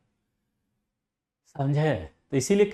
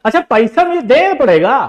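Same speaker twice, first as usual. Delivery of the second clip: high, loud mock-innocent question; cheeky student; 8 semitones higher, 11 dB louder than usual. Student asking teacher about money, cheeky high. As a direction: play the cheapskate pupil.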